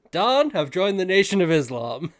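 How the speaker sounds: lightly voiced Roger, excited; playful mock announcement to Don.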